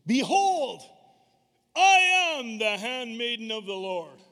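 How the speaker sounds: loud, high, slow proclamation; booming bold Behold; bold declaration. Retold or imitated. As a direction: proclaim it boldly like a servant of God. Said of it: "she says with this boldness".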